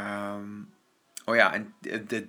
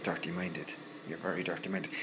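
lower, softer mock tut; teasing scold; 5 semitones lower, 8 dB quieter than usual. Real, not acted. Telling the viewers they are dirty-minded, teasing.

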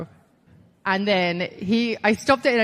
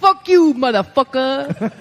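loud, stretched-out cussing impression; Dusty Rhodes; 4 semitones higher, 7 dB louder, slower than usual. Trainer cussing in front of cameras, loud and hammed-up. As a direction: holler it, dragging out the last word. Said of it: "this is a Dusty impression".

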